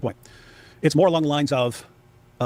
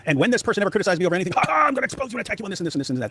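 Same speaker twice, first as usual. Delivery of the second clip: fast, higher gloating parody; mocking attacker; 5 semitones higher, faster than usual. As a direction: rattle off the threats like a gloating bully. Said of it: "pick up on anything that he can attack you on".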